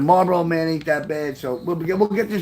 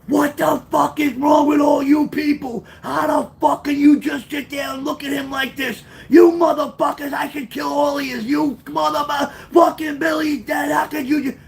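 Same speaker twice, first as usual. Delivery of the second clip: retold furious screaming, much higher; 10 semitones higher than usual. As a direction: rage at the mourners in a high yell. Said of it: "I started screaming".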